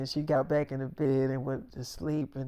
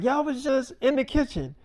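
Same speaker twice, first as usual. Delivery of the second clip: high, scandalized accusation; 10 semitones higher than usual. Retold or imitated. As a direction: call them out in shock. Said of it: "She's like".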